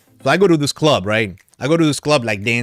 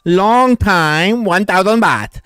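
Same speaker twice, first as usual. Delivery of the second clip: imitating a Thai bar girl's English, higher and louder; a playful Thai-accented sales pitch; 6 semitones higher, 4 dB louder, much slower than usual. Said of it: "in that Thai accent".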